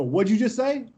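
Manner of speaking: high-pitched, incredulous challenge; retold challenge. Player to the kid who insulted him, retold challenge. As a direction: call out the insult you just heard.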